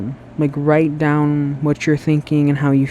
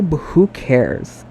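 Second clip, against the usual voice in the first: slow, unhurried who cares; slow shrug; 3 semitones lower, much slower than usual. Real, not acted. Vlogger shrugging off the bad video quality, said slowly. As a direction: let it stretch out, slow and easy.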